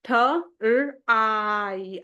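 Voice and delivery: "थ, र, आई" said as separate, drawn-out sounds; phonics sounding-out. Shown as a demonstration. Teacher showing parents how "थ, र, आई" is decoded, sound by sound. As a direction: break "थ, र, आई" into single slow phonemes. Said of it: "ट्राई को कैसे हमने किया, ये देखें".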